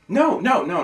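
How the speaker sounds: high, loud no, no; loud protest. Acted. Arguing with girlfriend on the phone, loud high protest. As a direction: cut her off hard.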